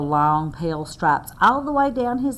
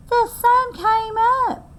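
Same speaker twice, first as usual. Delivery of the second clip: high bright chirp of a little squirrel; chirpy squirrel. Acted. Storyteller voicing Squirrel at sunrise: high chirping. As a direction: chirp it out proudly. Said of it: "he chirped".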